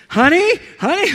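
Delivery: high, loud, urgent call to his wife; shouting to his wife at the door, overexcited; excited calling out. Retold or imitated. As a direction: yell for her across the house, thrilled.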